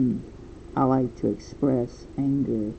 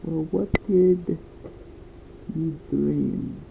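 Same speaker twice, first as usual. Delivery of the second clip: put-on Russian accent; 3 semitones higher, much slower than usual. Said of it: "Like in a Russian accent".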